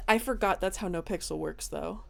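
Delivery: ordinary delivery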